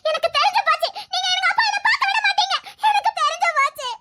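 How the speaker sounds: high, wailing accusation; anguished protest. Acted. Girl accusing officials of keeping her from father, anguished. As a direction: voice climbs into a despairing cry.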